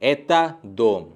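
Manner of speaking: एता दोम slow, falling like a statement. Shown as a demonstration. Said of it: "दूसरे sentences पे".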